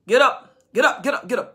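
loud, insistent wake-up call; urgent loud calling. Acted. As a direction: rouse someone out of bed. Preacher voicing wake-up calls.

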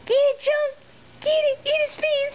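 high-pitched cheering of the cat; excited cheering. Real, not acted. Owner urging her cat Chunk to attack the toy fish, excited and high. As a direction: egg the kitty on, high and bright.

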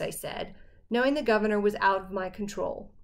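usual speaking voice

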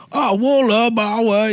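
high, loud, drawn-out mock Vietnamese; mocking fake Vietnamese. Drunk man making fun of his coworker, mocking fake Vietnamese. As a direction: wail nonsense Vietnamese syllables, high and slow, to make fun. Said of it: "like, making fun of".

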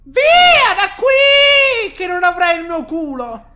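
high shrill yell at monster; shouting. Real, not acted. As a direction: scream it away in panic. (urla spaventata)

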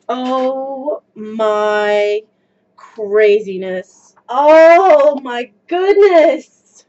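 slow, loud, stretched gush; drawn-out delight. Real, not acted. Gushing over her skirt, slow and loud. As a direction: savor every word of it.

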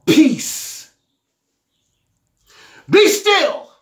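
commanding rebuke. Acted. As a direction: call the storm to stop with full authority. Jesus commands the sea.

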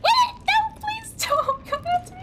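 high, frantic begging; panicked pleading. Real, not acted. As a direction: beg for your life, half laughing. Pleading while chased.

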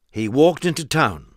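plain reading voice

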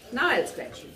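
ordinary delivery

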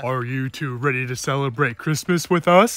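very low-pitched grandfather voice; grandpa. Acted. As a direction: play a grandpa, voice dropped right down.

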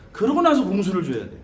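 ordinary talk

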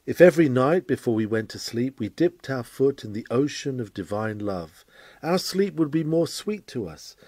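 ordinary talk

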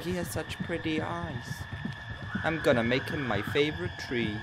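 a hint of a smitten woman sighing over his eyes; mildly swooning girly voice; imagined swooning woman. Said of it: "Maybe she was like".

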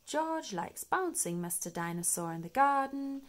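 plain reading voice

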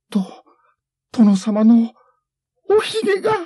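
very high, loud frightened voice; panicked cry. Acted. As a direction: cry out in shock. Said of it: "女が叫んだ".

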